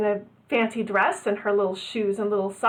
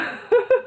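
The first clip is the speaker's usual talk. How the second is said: short laugh; 13 semitones higher than usual. Real, not acted. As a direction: laugh briefly in agreement. Shared laughter.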